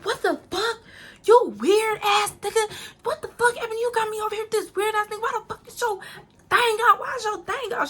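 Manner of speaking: high-pitched, outraged mimicry; going off. Shocked woman at RJ exposing himself, high outraged rant. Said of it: "She going off".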